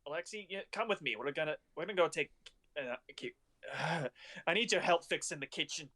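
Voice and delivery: high, hurried panic; panicked. Acted. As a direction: call out in a flustered rush. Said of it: "she kinda goes into a panic".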